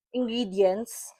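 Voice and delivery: ordinary talk, nothing marked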